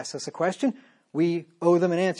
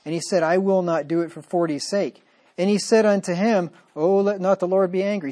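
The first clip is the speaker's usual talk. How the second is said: louder, measured King James reading; scripture reading; 2 semitones higher, 5 dB louder than usual. Acted. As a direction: read the verse with solemn weight. Reads scripture aloud in sermon.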